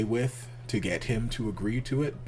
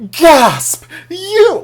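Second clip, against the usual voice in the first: spoken gasp, very high and loud; hammed-up shriek of shocked accusation; Daddy's telenovela shock; 19 dB louder than usual. Daddy spots someone as he rolls in, melodramatic. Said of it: "it felt very dramatic telenovela".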